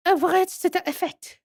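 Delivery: put-on high French-accented regret; French museum madam. Madam reporting failed terms, high French accent.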